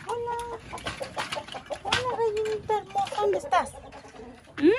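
high coaxing call to the hen; sing-song greeting. Real, not acted. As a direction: coo to the hen like a pet.

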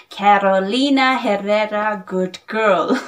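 lightly mimicking a famous perfume reviewer's foreign accent; Jeremy Fragrance accent. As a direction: say the brand name in a playful put-on reviewer's foreign accent. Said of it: "that is my Jeremy fragrance accent".

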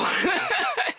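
high burst of helpless laughter; sudden high giggle. Real, not acted. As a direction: laugh in spite of yourself at the mess. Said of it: "I don't know why I'm laughing".

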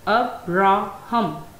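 "Abraham" slowly, broken into three separate syllables. Shown as a demonstration. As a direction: split "Abraham" into its syllables, pausing between each. Word split into its three syllables.

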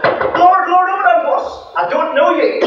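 high, loud desperate begging at the door; pleading crowd. Acted. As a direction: cry out to be let in. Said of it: "these people come up and knock".

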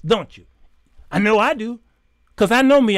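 ordinary delivery

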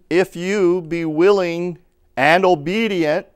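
slow, loud, weighty verse; emphatic scripture reading. Real, not acted. Preacher reading the verse to the congregation, slow and emphatic.

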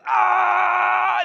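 long, high, loud "aah" scream; mock scream. Preacher imitating dad pinched by a crawdad, protracted scream. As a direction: scream out one drawn-out high note. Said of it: "he started screaming".

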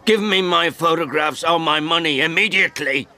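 high, furious demand; angry shout. Acted. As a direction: shout the ultimatum. Old man's angry shouted demand.

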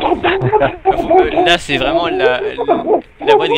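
squeaky voice through laughter; laughing high. Real, not acted. Mocking the gremlin voice, laughing.

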